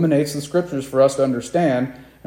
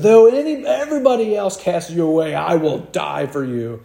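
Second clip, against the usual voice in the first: boastful Peter; 3 semitones higher than usual. Acted. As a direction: play the disciple swearing he'd never leave. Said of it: "Peter says".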